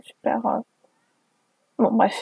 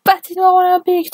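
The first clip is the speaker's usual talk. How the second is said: mock attack call; 8 semitones higher, faster than usual. Fanfic ice attack read aloud.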